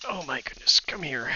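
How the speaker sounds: soft whispered coaxing; whispering. Real, not acted. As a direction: whisper it gently. Coaxing aside while cooking.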